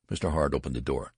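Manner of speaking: ordinary delivery, nothing marked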